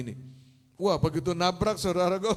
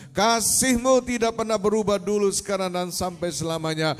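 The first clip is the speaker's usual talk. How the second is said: loud, emphatic declaration to God; fervent preacher's prayer; 2 semitones higher, 6 dB louder than usual. Real, not acted. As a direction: build each declaration up to a big Amen. (doa khotbah yang lantang dan berapi-api)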